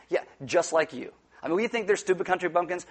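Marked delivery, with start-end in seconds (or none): none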